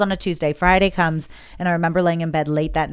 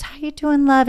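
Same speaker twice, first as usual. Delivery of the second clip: sweet greeting; 9 semitones higher, 4 dB louder than usual. Retold or imitated. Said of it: "And she goes".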